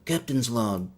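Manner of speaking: lightly voiced captain opening his log; Captain Kirk. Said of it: "more committed to being Kirk".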